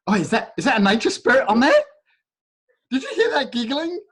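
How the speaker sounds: light up at the sound; real giggly delight; delighted surprise. Real, not acted. Reacts to child's giggle on call.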